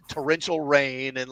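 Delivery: ordinary talk, nothing marked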